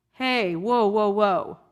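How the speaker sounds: mild mock call to stop; calling a halt. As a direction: pull a friend up short. Imagined Yitro stopping someone.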